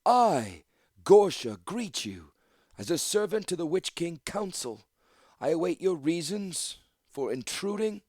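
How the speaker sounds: put-on high-pitched formal old priestess; aged woman's voice. A narrator's reading. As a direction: challenge intruders as an ancient gatekeeper. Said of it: "only her voice hinted at her age".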